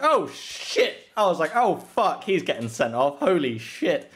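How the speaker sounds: high, loud alarmed cursing; retold shock. Friend retells reacting to the bad tackle, alarmed. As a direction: blurt it out in alarm. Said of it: "and I was like".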